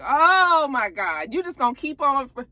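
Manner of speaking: real fed-up disbelief; exasperated. Real, not acted. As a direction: drag it out, fed up. Speaker exasperated at Nate.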